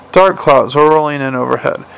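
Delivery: usual speaking voice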